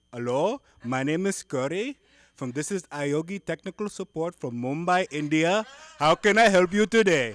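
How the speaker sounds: hammed-up Indian call-centre agent; Indian accent. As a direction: play a Mumbai support rep, loud. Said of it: "he was just like".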